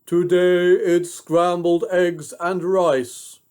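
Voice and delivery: slow, loud mirror answer; drawn out like an enchanted object; magic mirror voice. A narrator's reading. Mirror answering the queen, slow and booming. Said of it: "the thing would answer".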